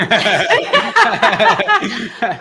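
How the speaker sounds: loud, high burst of laughter; hearty real laugh. Real, not acted. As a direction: let out a big laugh. Big laugh.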